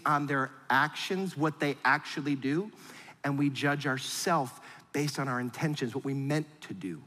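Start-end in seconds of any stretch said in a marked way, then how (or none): none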